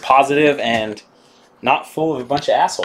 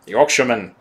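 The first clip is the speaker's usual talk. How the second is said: bad Yorkshire accent. Greeting a Yorkshire viewer in a mock Yorkshire accent. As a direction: attempt a Yorkshire accent and miss. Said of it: "That's an awful accent".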